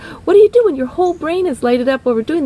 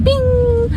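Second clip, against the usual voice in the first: high-pitched "bing" sound effect; 11 semitones higher than usual. Retold or imitated. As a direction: chime it like a little bell. Imitates brain area lighting up.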